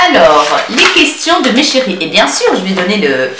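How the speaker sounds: ordinary talk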